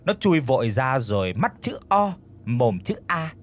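ordinary delivery

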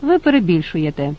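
ordinary delivery

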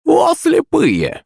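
high, slow crone exclamation; exasperated witch cry. Acted. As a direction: throw up hands like a hag. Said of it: "всплеснула руками старуха".

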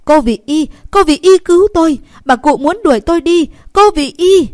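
high, loud cry for rescue; frantic, full-voiced call for a protector; screaming out. A narrator's reading. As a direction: yell for help at a high pitch. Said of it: "liền lớn tiếng hét lên".

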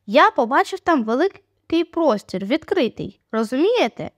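usual speaking voice

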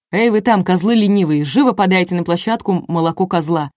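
usual speaking voice

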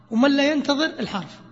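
ordinary talk, nothing marked

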